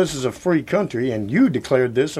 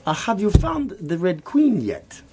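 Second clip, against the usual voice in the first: hammed-up fake Chinese accent; a mock Chinese accent; 2 semitones higher than usual. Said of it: "in a fake Chinese accent".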